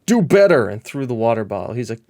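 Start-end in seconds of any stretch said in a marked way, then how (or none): 0.1-0.7 a sharp yell at the players, louder and higher; retold yell. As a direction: bark it at the team like an angry coach. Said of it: "when I yelled at them".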